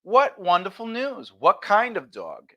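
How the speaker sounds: slow, delighted response; light up for their news, slowly; warm interested reply. Shown as a demonstration. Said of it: "a stronger tack response".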